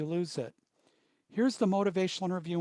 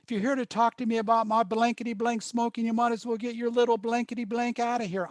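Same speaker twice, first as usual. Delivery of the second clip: fast, higher-pitched rant of an irate man; retold angry screaming; 6 semitones higher, 3 dB louder, faster than usual. Irate smoker confronting the counselor in his hospital visit, mock screaming. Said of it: "screaming at me, spitting at me".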